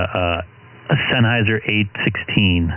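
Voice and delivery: ordinary delivery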